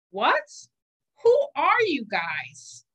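startled rising what, slow who; a boy suddenly alarmed; surprised. Acted. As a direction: caught off guard by strange voices. Simon surprised by germs.

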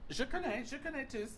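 high, bright attempt at French; playful French. Real, not acted. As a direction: pitch up playfully while trying French. Host tries French.

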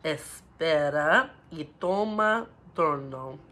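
put-on slow, careful Spanish phrase for kids; slow demo. Shown as a demonstration. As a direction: model it slowly, syllable by syllable. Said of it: "having a slow accent".

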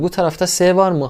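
ordinary talk, nothing marked

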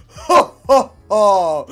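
loud fake ha ha; mock laugh. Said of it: "I kept on going".

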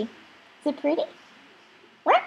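ordinary talk, nothing marked

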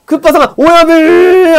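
calling out. Acted. Minion calls out boss.

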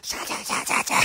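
unvoiced, rhythmic 'sha' sounds; scrubbing noise. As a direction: imitate frantic soap scrubbing. Imitates scrubbing with soap.

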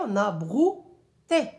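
"brouté" split as brou-té, drawn out; slow syllable count. Shown as a demonstration. Teacher shows class the two syllables of "brouté". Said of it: "deux syllabes".

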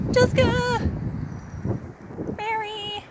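high calling voice. Real, not acted. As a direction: call across the cemetery. Calling out at cemetery.

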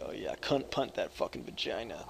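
ordinary delivery

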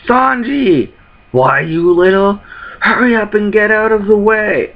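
a hint of a shouting brawler; mock angry shout. Character yells at Sanji to move, mock annoyance.

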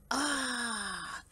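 long, falling "ah" of relief; soft, drawn-out sigh; relieved sigh. Acted. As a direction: let the tension out in one breath. Bear relieved to find no mouse.

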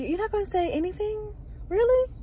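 high, quick disbelieving question; acted-out disbelief ending on a high "really"; incredulous. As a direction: question their silence in disbelief, pitch climbing. Acts out her disbelief at silence.

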